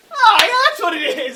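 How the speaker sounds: hammed-up realization by the hot tub stranger; mock eureka. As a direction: play the stranger finally placing his face. Stranger mock-realizes the Instagram link.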